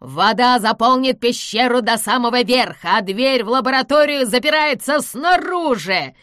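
loud, high proclamation of doom; crazed gloating. A narrator's reading. Villain tells them they are trapped, gloating. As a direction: crow loudly over the trap.

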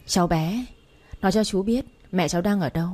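usual speaking voice

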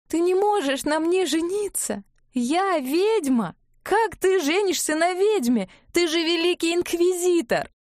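high, tearful protest; despairing cry. A narrator's reading. Ivga cries to Klavdiy that she is a witch, despairing. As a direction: wail it, pitched high.

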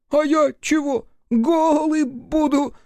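very high squeaky outraged creature; indignant imp. A narrator's reading. Imp protests to Рух in a high indignant squeal. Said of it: "возмутился Шеликун".

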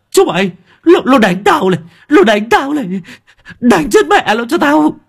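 high, loud, furious shriek; shrieking command. Acted. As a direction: scream at the gang to attack. Thug boss orders gang shrieking. (gào thét the thé ra lệnh đánh)

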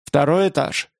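ordinary delivery

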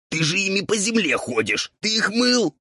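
very high, raspy outrage; indignant ghoul. A narrator's reading. Ghoul appalled by devil's dirty hooves, indignant. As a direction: climb into outraged disbelief. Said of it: "возмутился упырь".

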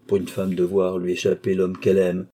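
usual speaking voice

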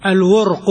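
الورق said al-wurqu, u after the waw; vowelling of الورق. Shown as a demonstration. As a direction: model الورق with silent-vowel ra and u on the qaf. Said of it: "بضم الواو وسكون الراء".

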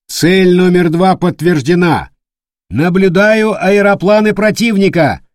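high, loud battle report; shouting. Acted. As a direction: report it loud over the noise.

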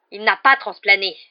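loud furious roar; enraged roar. A narrator's reading. Rogue contradicting the others as they approach, roaring. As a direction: roar the denial at full voice. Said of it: "rugit Rogue".

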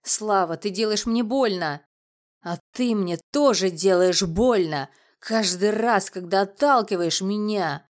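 loud, pained complaint; wounded, emphatic outcry; tearful reproach. A narrator's reading. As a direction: plead loudly through hurt, a touch tearful. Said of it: "слёзно произнёс он".

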